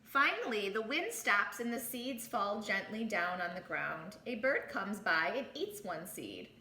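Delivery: plain reading voice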